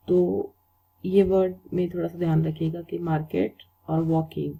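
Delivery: ordinary talk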